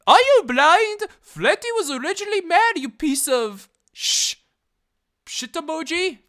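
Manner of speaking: high, loud squeaky tirade; hammed-up comment rage; mock outraged rant. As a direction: rant like an angry kid commenter. Reading angry comment aloud.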